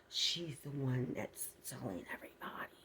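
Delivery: unvoiced gossiping whisper. Neighbour gossiping quietly.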